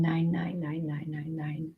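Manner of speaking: faint whisper, fading away. Real, not acted. Medium echoing the number she hears, whispered. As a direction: breathe the numbers like an echo.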